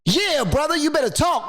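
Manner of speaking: high-pitched mock amen-corner response; congregant egging on. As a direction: urge the speaker on from the crowd. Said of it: "get one person to say".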